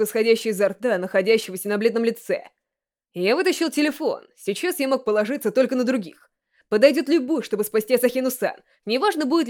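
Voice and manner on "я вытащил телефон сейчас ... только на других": ordinary delivery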